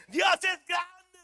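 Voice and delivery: high, loud, drawn-out praise; long exultant cry, played big; jubilant shout. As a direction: yell it in sudden re-enacted triumph. Acts jubilant shout after cheque arrives.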